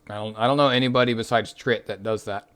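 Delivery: sleepy, drowsy voice; nearly falling asleep; nodding off. Real, not acted. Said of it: "I'm falling asleep at my chair".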